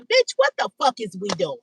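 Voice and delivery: a hint of an exasperated scolder; mocking body-part voice. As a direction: voice her body cussing her out, lightly acted. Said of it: "Your pussy is even saying".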